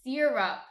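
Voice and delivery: "Syrup" said loudly as SEER-up; Jersey pronunciation. Shown as a demonstration. Correcting partner on a Jersey word, "Syrup" as SEER-up. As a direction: stress a long ee at the start of "Syrup". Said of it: "It's not syrup".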